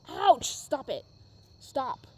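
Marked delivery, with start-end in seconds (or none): 0.1-0.5 sudden sharp ouch, louder; startled real ouch. Real, not acted. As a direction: yelp as the cat scratches. Cat scratches her.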